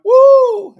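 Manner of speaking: very high, loud whoop; imitated falsetto shout. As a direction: let out a singer's piercing "woo". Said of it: "Ain't that how she do it?".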